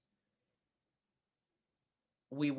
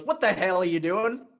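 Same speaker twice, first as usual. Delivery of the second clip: mock shout; 4 semitones higher, 8 dB louder than usual. Truck driver yelling at hitchhiker.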